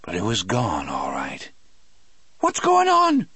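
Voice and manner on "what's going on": very high, loud shrill Cajun voice; shrill call. A narrator's reading. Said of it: "Delacroix called shrilly".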